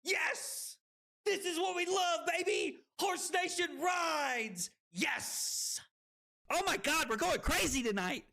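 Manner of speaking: high-pitched, ecstatic streamer celebration; real hype shouting. Real, not acted. Streamer celebrates a wild win, ecstatic shouting. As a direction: yell it out, overwhelmed with excitement.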